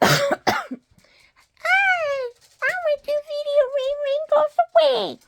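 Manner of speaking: high squeaky toy character; childlike falsetto puppet voice; little dragon voice. Pretend. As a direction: pipe up as a tiny dragon.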